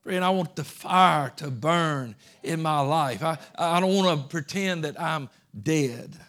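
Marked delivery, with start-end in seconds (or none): none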